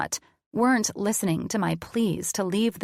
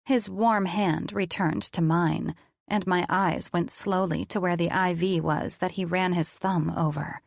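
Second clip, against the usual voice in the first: ordinary delivery, nothing marked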